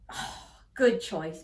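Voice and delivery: ordinary delivery, nothing marked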